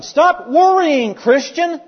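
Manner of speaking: loud, high, drawn-out scolding; mock stern command. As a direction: order the believer sternly, stretching it out high. Acts out a bare command.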